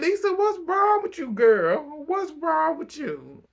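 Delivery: high, exclaiming, on the edge of laughter; amused disbelief. Real, not acted. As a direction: call her out in helpless amusement. Reacting to Lisa, cracking up.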